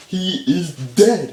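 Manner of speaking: loud, high, very slow announcement; mock proclamation. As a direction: proclaim it word by word, hammed-up. Mom announces death to the crowd.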